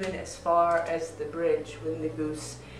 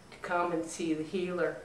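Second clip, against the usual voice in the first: plain reading voice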